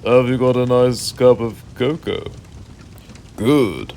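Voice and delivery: normal talking voice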